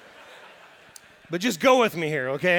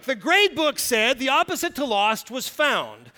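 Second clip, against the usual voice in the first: ordinary delivery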